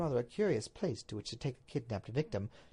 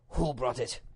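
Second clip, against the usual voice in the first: plainly pitched demand from the Duke; Duke's question; 2 semitones lower than usual. Acted. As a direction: ask it straight, as the husband.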